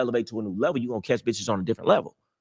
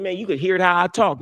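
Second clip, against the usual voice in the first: ordinary delivery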